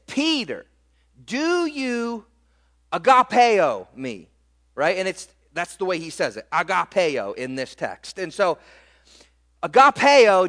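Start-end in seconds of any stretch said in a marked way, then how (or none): 0.1-4.3 agapeo pronounced ah-gah-PAY-oh, slow and louder; stress on agapeo. Acted. Jesus pressing Peter with the Greek agapeo, slow and emphatic. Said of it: "Jesus says this". 9.6-10.5 agapeo said ah-gah-PAY-oh, loud and high; Greek word agapeo. Shown as a demonstration. Pastor introducing the Greek word agapeo to the church. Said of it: "simple breakdown of this Greek wording".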